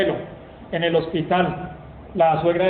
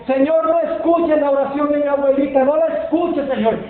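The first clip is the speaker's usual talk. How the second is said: put-on child's plea; 7 semitones higher than usual. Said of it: "uno de los nietos ... le dijo".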